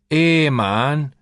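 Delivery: 'Here, man.' in a high, loud Jamaican street lilt; mock yardie cry of 'Here, man.'; yardie accent. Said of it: "they thought he was saying".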